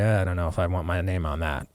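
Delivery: low, soft, doubtful mumble; hesitant juror. Retold or imitated. Grand juror backing away, low and soft. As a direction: hedge like a wary juror. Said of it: "look at that and say".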